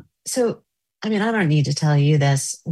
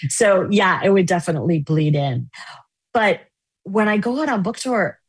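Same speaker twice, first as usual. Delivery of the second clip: usual speaking voice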